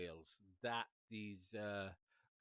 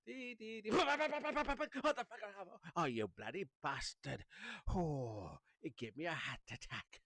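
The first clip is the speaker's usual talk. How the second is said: imitating a startled person; 10 semitones higher, slower than usual. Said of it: "all of a sudden it's like".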